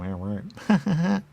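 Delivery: high, loud scoffing "yeah right"; mock it with a high, loud sneer; a sarcastic jeering reply. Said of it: "You go".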